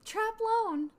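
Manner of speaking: loud, high announcement; announcing. Acted. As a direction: call out the house name. Announcing sorting result aloud.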